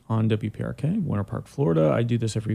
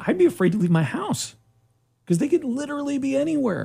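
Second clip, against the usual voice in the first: mock fear; faster than usual. Mock fear of camo swans.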